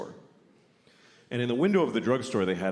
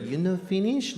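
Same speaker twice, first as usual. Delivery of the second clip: put-on Japanese accent; 9 semitones higher than usual. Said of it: "repeat people's bad English".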